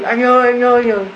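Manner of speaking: high, loud cry for help; a neighbour shouting the man's name; calling out. Retold or imitated. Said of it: "vậy mà cứ la lên".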